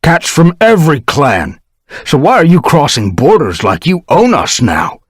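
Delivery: high, loud angry accusation; angry rebuke. Acted. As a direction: lash out at ThunderClan. One Star angry.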